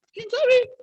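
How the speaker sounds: high, loud mocking who-knows; mock shrug. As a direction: toss it off in a high, careless voice. Mocking indifference to the Lord. (¿quién sabe? agudo y fuerte, con burla)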